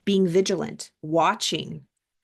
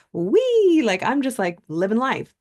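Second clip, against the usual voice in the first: giddy wee; 4 semitones higher, 4 dB louder than usual. Retold or imitated.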